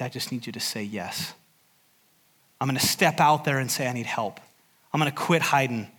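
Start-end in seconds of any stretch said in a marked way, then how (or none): 0.0-1.3 gentle invitation. Acted. Preacher voicing God's gentle invitation. 3.9-4.4 quiet plea. Acted. Preacher voicing a listener's plea.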